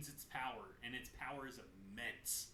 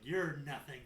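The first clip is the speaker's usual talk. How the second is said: mild angry snap; 9 dB louder than usual. Retold or imitated. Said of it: "Loses her temper yet again".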